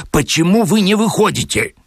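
high, indignant question; acted outrage at contained volume; exasperated demand. A narrator's reading. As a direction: demand an answer, pitched well up. Angry demand to man locked in.

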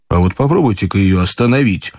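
ordinary delivery

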